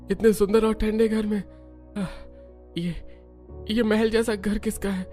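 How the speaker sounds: halting words with groans and long pauses; dazed, wounded waking. Acted. Wounded man waking up, confused. (घायल, अटक-अटक कर हैरानी से)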